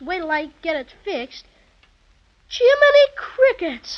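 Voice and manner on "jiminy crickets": high, slow gasp of wonder; amazed. Acted. Boy astonished at the toy bear through the telescope, high and amazed. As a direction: exclaim as if you can't believe your eyes.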